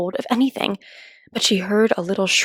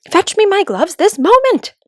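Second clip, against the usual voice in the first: much higher, louder fussy command; impatient rabbit order; 11 semitones higher, 6 dB louder than usual. Acted. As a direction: bark the order at the servant.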